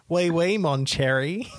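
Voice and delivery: a broad Australian trying French; Aussie bogan mangling French; bogan French accent. Retold or imitated. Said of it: "someone doing French in a".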